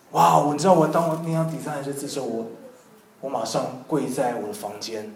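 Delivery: ordinary talk, nothing marked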